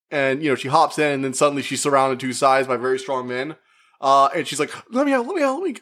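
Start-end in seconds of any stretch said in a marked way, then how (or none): 4.9-5.8 high, frantic mock pleading to be let out; panicking. Kidnapped woman begs to be let out, panicked high plea. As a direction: act trapped and panicking, voice up high. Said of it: "she's just panicking".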